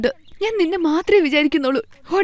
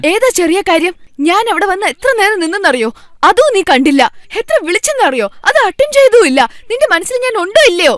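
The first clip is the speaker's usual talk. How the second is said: raised, higher, accusing voice that climbs to a shout; hurt fury, louder with every complaint; angry outburst; 4 semitones higher, 4 dB louder than usual. Acted. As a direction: girlfriend scolding her boyfriend, rising to a furious shout. Girlfriend's angry outburst at boyfriend.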